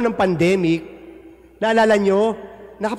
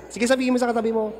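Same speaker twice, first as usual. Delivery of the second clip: ordinary delivery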